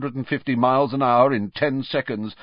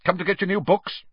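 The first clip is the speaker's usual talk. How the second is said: high-pitched abrupt query; 10 semitones higher, faster than usual. A narrator's reading. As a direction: ask it briskly, voice raised in pitch. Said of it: "he said abruptly".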